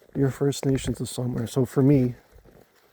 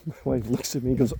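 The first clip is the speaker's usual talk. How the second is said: usual speaking voice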